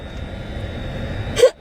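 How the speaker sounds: an airless sob, mostly breath; breathless sob-laugh. Real, not acted. Crying on camera, whispered sob-laugh.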